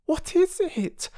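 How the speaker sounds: high, slow anxious question; frightened girl. A narrator's reading. Little girl uneasy at the moving trees, high and anxious. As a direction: ask it nervously. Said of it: "said Phyllis".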